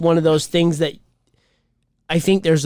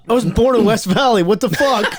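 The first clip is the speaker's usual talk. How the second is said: higher and louder mock outrage; indignant retort; 6 semitones higher, 4 dB louder than usual. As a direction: play the offended local who gets talked to in Spanish. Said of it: "He says".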